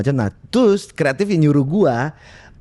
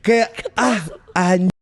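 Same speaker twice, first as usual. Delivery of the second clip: Jaksel slang; 4 semitones higher, slower than usual. Shown as a demonstration. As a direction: play a brash South Jakarta kid. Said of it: "Kalau logat Jaksel gimana?".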